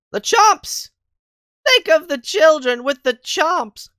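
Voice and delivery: high, drawn-out mock outcry; hammed-up slow, loud pleading; mock-dramatic plea. Streamer mock-pleads for the children, hammed-up outcry.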